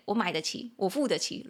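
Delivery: ordinary talk, nothing marked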